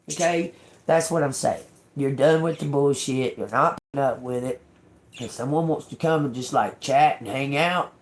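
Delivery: ordinary delivery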